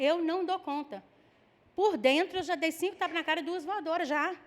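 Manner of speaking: normal talking voice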